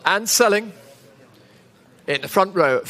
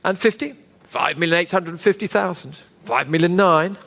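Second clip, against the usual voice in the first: auctioneer; 3 semitones lower than usual. Real, not acted. Live auction bid calling.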